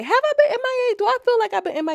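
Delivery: high put-on voice asking itself; mock questioning voice. Mocking herself to viewers, in a high squeak. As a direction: pitch way up like a teasing aside. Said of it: "I feel like I've been kinda M I A".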